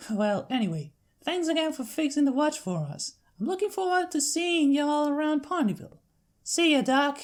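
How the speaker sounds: friendly big-sister country voice; Applejack's country accent. Acted. Applejack sending her sister to the farm, Southern accent.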